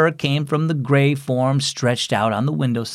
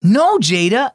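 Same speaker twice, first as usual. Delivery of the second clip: sudden higher, louder cry of warning; alarmed exclamation; 8 semitones higher, 4 dB louder than usual. Acted. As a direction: shout to stop his sister in alarm. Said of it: "exclaimed Nolan".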